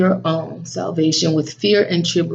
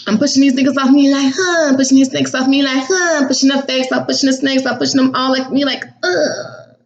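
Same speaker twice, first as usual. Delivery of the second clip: mock push-off; 7 semitones higher than usual. Acting out pushing people away.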